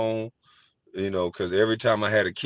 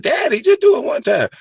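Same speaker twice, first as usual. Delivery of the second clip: put-on high pleading child voice, much louder; kid begging daddy; 8 dB louder than usual. Kids pleading with daddy at the park, high begging child voice. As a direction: beg like a little kid. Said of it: "they be like".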